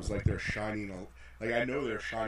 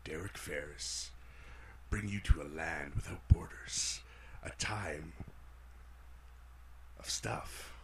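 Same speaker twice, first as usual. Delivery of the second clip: low, slow, breathy trailer narration; movie trailer voice; 3 semitones lower, slower than usual. Acted. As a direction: whisper it like an epic movie trailer. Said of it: "I was doing my movie voice there".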